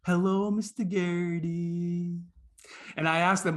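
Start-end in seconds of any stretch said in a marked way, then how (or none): 0.1-2.2 slow, drawn-out class greeting; first graders in unison. Retold or imitated. First graders greeting a guest, slow mock unison chant. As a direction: imitate a class of small children chanting together. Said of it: "all go in unison".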